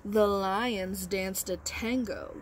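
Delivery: slow, expressive picture-book reading; reading to children. Acted. Teacher reads Giraffes Can't Dance aloud to her class. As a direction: read with wide storybook expression.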